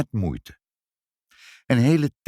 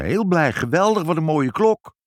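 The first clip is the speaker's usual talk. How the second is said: high, emphatic gushing; delighted; 6 semitones higher, 4 dB louder than usual. Acted. Mother admiring the wished-for clock, delighted. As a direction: gush with rising pitch.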